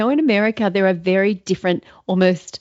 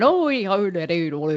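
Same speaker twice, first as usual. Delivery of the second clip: sing-song Fargo nonsense syllables, falling away; lyrical Fargo accent; 2 semitones lower than usual. Retold or imitated. Host mimicking how lyrical it sounds, Fargo accent. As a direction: lilt the tune of a Fargo accent. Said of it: "It's so lyrical, isn't it?".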